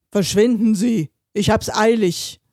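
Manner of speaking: loud, forceful shout; angry bellow. A narrator's reading. Driver in a hurry yells from car window, angry bellow. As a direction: bellow impatiently to clear the way. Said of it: "und brüllte".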